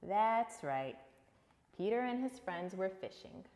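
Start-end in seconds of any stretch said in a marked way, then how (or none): none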